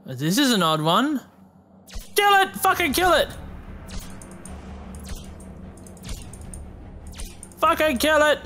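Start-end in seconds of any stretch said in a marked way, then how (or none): 2.2-8.4 frantic yell. Real, not acted. Gamer yelling at the flying alien, panicked shout. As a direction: scream at the creature to die.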